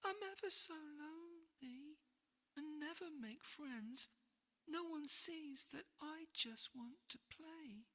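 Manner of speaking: high, soft little dragon voice, sad; a small sad dragon near tears; lonely dragon. Acted. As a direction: confess loneliness softly. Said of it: "it burst into tears".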